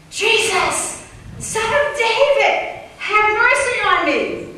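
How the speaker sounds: high, loud desperate plea; crying out. Acted. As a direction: cry out to Jesus from the roadside. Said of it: "this man cried out saying".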